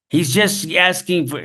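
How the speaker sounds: high, drawn-out retold protest. Argument over a hungry man asking for food, high protest. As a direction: act out the argument, pitched well up.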